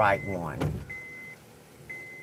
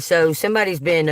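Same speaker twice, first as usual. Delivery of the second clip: ordinary talk, nothing marked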